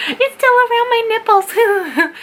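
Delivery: put-on high, slow kid voice; like a giggly little kid; childish voice. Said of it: "My juvenile side's coming out".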